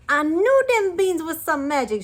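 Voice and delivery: excited shout. A narrator's reading. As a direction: cry it out with glee. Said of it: "Jacques shout".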